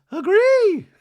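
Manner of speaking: very high, loud squeaky voice; hammed-up high Dorit voice; mock Dorit. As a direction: insist like a prim diva. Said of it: "Dorit goes".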